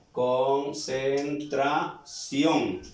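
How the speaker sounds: "Concentración" stretched out very slowly, syllable by syllable, ending on stressed "ción"; stress on last syllable. Shown as a demonstration. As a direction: break "Concentración" into long syllables, voice weight on "ción". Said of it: "Mayor fuerza de voz". ("Concentración" lentísimo, separando sílabas, acento en "ción")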